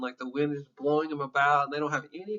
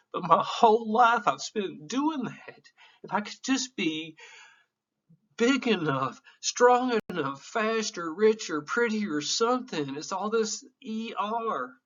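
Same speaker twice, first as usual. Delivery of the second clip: higher, slow, welling up with emotion; emotional confession, higher and slower; choked up; 6 semitones higher than usual. Real, not acted. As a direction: let the voice climb as it cracks. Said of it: "sorry for getting so emotional".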